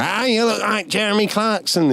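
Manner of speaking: put-on high Liverpool drunk voice; drunken Scouse footballer impression; Scouse accent. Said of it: "in his fucking Scouse accent".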